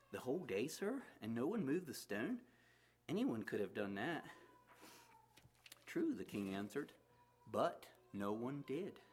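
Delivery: lively read-aloud storytelling voice with acted characters; story narration. A narrator's reading. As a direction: tell the story to the listeners. Reads a story aloud to congregation.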